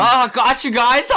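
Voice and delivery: high, loud forced cackle; hammed-up fake laughter. Co-host playing Alex covering up to friends, hammed-up laughing shout. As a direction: shout it through an exaggerated phony laugh. Acted cover-up of confused boy.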